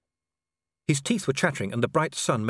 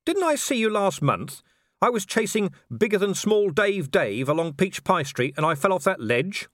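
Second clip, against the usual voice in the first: high, urgent pleading; agitated; 8 semitones higher than usual. Acted. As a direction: press the point urgently. Man arguing with Death.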